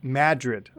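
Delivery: "Madrid" as "MAD-rid"; "Madrid" with first-syllable stress; local town pronunciation. Shown as a demonstration. Listener repeats the local pronunciation.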